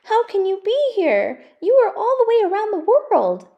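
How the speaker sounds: can't believe she's really here; astonished, overjoyed boy; amazed child. Acted. Gus amazed mother is here.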